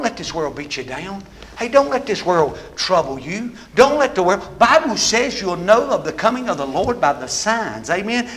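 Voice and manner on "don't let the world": normal talking voice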